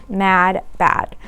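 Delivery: plain reading voice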